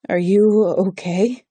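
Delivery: slow, worried, unsteady question; shaky voice. A narrator's reading. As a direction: ask slowly with a slight tremble of concern. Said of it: "My voice is shaky".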